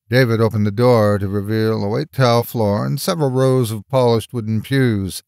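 plain reading voice

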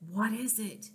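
soft, hushed aside; whispered. A narrator's reading. June asking Aunt Rose secretly, soft whisper. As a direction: ask it under your breath. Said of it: "she whispered to Aunt Rose".

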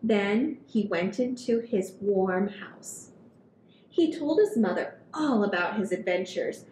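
put-on gentle read-aloud for kids; storybook reading. A narrator's reading. Reading picture book to children.

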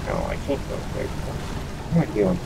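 whispered to himself; under his breath. Real, not acted. Muttering to self while playing.

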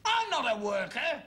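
high, indignant retort; indignant protest. Acted. Dad rejecting the class traitor charge, high indignation.

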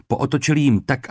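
plain reading voice

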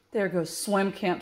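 ordinary delivery, nothing marked

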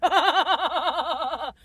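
loud, long rolling laugh; hearty laugh. Real, not acted. Driver laughing over keeping his beard, loud rolling laugh. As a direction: burst into a rapid run of ha-ha-has.

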